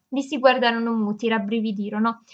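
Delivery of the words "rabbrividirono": ordinary delivery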